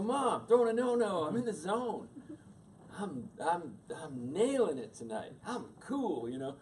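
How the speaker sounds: high, loud boast to mom; excited, defensive kid voice; cocky teen retort. Retold or imitated. Retelling his teenage retort to mother.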